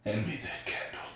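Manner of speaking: whispered movie quote; breathy hushed request. Retold or imitated. Quoting the movie line.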